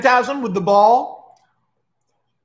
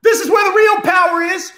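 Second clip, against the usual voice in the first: high-pitched impassioned proclamation; theatrical outraged yelling, sustained high; rallying shouted speech; 12 semitones higher, 4 dB louder than usual. Acted. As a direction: expose the betrayal to a huge crowd. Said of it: "holds up the cube and he goes".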